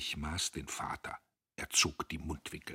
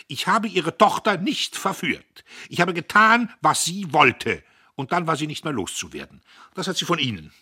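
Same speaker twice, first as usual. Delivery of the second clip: much higher and louder angry rant; getting worked up; 11 semitones higher, 13 dB louder than usual. Acted. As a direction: lose his temper step by step. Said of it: "fing an, sich aufzuregen". (Diederich regt sich auf: erregte Tirade)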